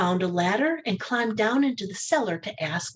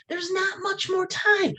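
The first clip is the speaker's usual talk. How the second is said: shouted; 12 semitones higher than usual. Acted. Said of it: "shouted the little boy".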